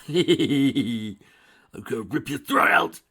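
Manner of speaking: loud, slow, dragged-out threat; slow furious yell. A narrator's reading. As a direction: growl each word out in rage. Dragon threatens the villain.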